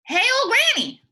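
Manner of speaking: loud high call out; a big toothy alligator calling Granny. Acted. Alligator calling Granny from the river, loud high hail.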